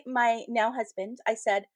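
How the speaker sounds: ordinary talk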